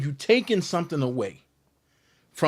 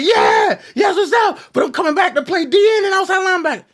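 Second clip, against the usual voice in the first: hyped shout, much higher and louder, fast; pumped to tell the coach his position; excited; 9 dB louder than usual. Retold or imitated. Eager reply to the coach.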